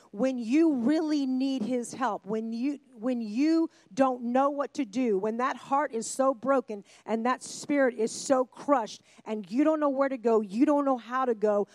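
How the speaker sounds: 하나님의 도우심을 외치듯 힘주어 설교; fervent preaching. Acted. Preaching build to God's help.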